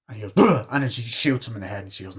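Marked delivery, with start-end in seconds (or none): 0.4-0.7 mock death grunt. Killer shot in the head.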